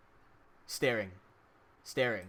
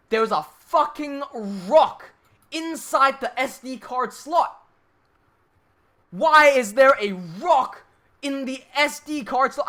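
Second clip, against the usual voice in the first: louder and higher, slow and drawn out; outraged disbelief; 5 semitones higher, 6 dB louder, much slower than usual. Real, not acted. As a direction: spell out the absurdity with rising outrage. Outraged at finding a rock.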